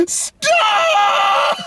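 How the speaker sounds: long high mock scream; movie-quote scream. Steamroller victim from Austin Powers, drawn-out screaming. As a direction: wail it out like a comic steamroller victim. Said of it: "From Austin Powers".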